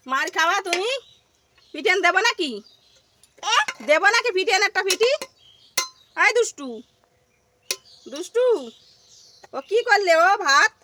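pretend-stern telling off, not really angry; mock scolding. Real, not acted. Mother jokingly threatens her son over the rice, mock stern.

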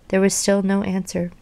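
usual speaking voice

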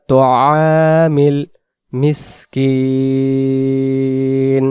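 very slow chanted recitation; Quran recitation. Shown as a demonstration. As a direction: draw out মিসকিন for three alifs. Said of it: "recites the verse in tajweed". (ধীর তিলাওয়াত)

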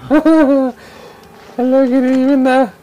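high, a hint of a mock polite host; mock greeting. Player mockingly greeting a stranger, high mock politeness. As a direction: play it falsely polite.

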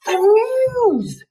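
high, loud yell over the crowd. A narrator's reading. Lucy on the truck yelling to the children, loud and high. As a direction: shout it above the noise. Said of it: "she yelled".

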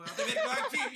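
laughing mock of a sergeant's Urfa accent. Sergeant barges in asking for Ataberk, laughing Urfa accent. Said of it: "Böyle bir şiveli de bir Urfalı mı?".

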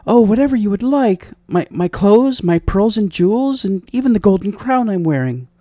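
high, pleading princess voice; high-pitched princess, desperate to bargain; eager princess. Acted. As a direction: offer everything in a high princess voice. Princess offers her treasures.

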